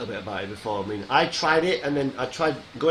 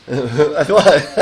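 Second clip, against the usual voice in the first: usual speaking voice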